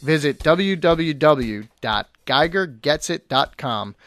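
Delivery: upbeat radio ad read. Acted. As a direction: sell it like a commercial. Reads a sponsor ad.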